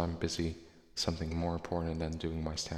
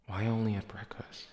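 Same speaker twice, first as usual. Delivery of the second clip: whispering; 3 semitones higher than usual. Real, not acted. Mock-secret question.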